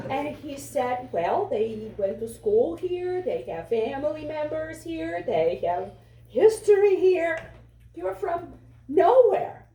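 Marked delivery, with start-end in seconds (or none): 1.1-9.7 from steady to high and loud, slowing on the last words; the boss scoffs that he is from nowhere; dismissive boss. Retold or imitated. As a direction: build to a scornful put-down. Quoting the boss dismissing him.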